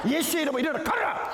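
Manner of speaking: high, fast command through laughter; mock earnest healing cry; commanding prayer. Pastor commands the crippled man. (웃음 섞인 명령)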